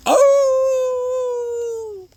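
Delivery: loud, high, long-held wolf howl. Shown as a demonstration. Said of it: "howl like a wolf at the top of your lungs".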